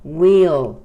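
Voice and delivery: "Will" as a loud, long "weel"; pronounced "we'll". Shown as a demonstration. Teacher repeating the contraction before stand, "Will" stretched to "weel". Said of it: "Se pronuncia will".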